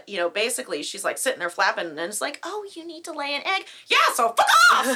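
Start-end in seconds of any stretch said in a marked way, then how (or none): 2.4-5.0 high chicken voice bursting into a loud squawk. Acted. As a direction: screech it like an angry hen. Acting out the clucking chicken, squawk.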